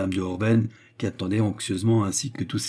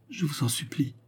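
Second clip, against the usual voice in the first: a higher, softer faltering plea; stammering plea; 6 semitones higher, 5 dB quieter than usual. A narrator's reading. Hostess begging Destour not to take revenge, stammering. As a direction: beg him, stumbling on the words.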